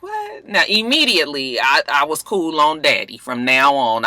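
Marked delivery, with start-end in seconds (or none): 0.0-0.5 high, breathy "What?"; shocked. Retold or imitated. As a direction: squeak it in disbelief. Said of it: "I was like".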